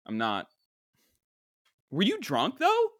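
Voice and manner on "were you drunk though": incredulous. Real, not acted. Incredulous drunk question.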